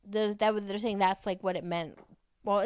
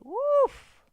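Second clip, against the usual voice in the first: sharp high yelp of a woof, much higher and louder; 9 dB louder than usual. Real, not acted. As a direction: bark it out in shocked disbelief. Reacting to a gaslighter's lines.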